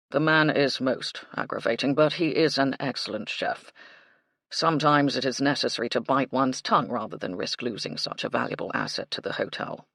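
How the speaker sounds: formal manservant, dry and restrained; proper butler, weary; stiff butler. A narrator's reading. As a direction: confide with buttoned-up dignity. Baxter's voice.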